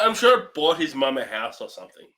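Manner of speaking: usual speaking voice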